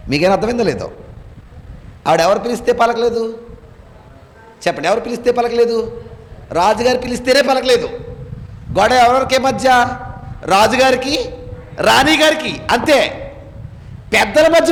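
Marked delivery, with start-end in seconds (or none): none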